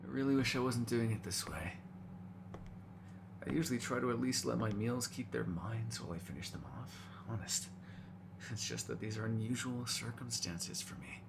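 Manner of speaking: soft, subdued voice; quiet confession. Acted. Vampire explaining to a meal why it must feed, quiet confession. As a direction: confess it softly, almost privately.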